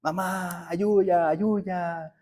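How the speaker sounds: high, soft imitated child's wail; drawn-out mock cry for mother, held back in volume; child crying in pain. Father imitates his son pleading for help in the dentist's chair, pained high wail. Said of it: "está gritando así, pues, con mucho dolor".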